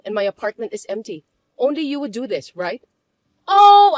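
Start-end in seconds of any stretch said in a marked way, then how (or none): 3.5-4.0 high, loud taunting oh; mocking oh. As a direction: sing out a smug oh. Mocking sister-in-law quarrel.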